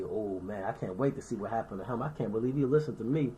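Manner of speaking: low, soft sly gloat; gloating aside. Retold or imitated. Envious brother gloating quietly to himself. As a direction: quietly relish his downfall, behind his back.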